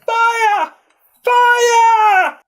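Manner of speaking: loud, very high alarm shout; piercing cry, bigger the second time; yelling a warning. A narrator's reading. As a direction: holler it to warn the whole station. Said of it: "started yelling".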